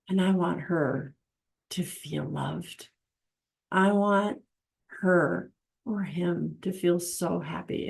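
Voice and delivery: soft, slow and loving; tender. Retold or imitated. Husband buying a card for his wife, soft and tender. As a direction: say it gently, full of love. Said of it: "purchase that card and say".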